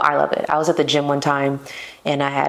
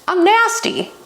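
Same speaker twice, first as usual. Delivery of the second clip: self-mocking squeal. Real, not acted. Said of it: "I'm not a good flirt".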